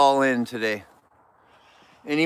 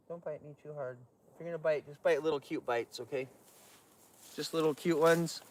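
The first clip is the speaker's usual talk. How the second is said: soft voice to an animal; 12 dB quieter, faster than usual. Real, not acted. Talking softly to a bear.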